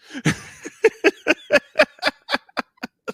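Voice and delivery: real burst of high-pitched laughter. Real, not acted. Laughs at own embarrassment.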